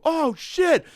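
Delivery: high, loud stunned exclamation; the whole room reacting at once; crowd gasp. The bar crowd seeing him catch the glass, high and loud. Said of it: "I felt people go".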